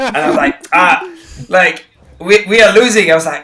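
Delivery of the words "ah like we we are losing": higher and louder, carried away by the crowd; a fan swept up by a rocking stadium; excited outburst. Retold or imitated. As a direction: blurt it out in thrilled disbelief. Retelling his thrill at rival stadium.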